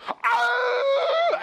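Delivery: very high, loud baboon shriek; screaming like an angry baboon; baboon yell. Retold or imitated. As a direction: let out a long imitated baboon roar. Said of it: "he would come and yell".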